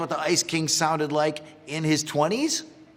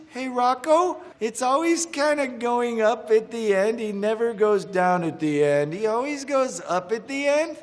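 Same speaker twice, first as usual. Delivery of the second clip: a higher voice with every sentence rising at the end; always going up at the end; 8 semitones higher than usual. Shown as a demonstration. Voice actor showing how Heifer ends every sentence, with higher, rising endings. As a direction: make each line end on an up like Valley Girl talk. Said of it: "going up at the end of the sentences".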